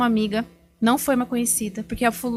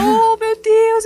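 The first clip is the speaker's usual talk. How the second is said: loud, high, drawn-out wail; mock tearful wail; 12 semitones higher, 11 dB louder, much slower than usual. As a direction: wail it hammed-up, overcome with emotion. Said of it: "com a cartela e eu chorando". (choro exagerado, agudo e arrastado)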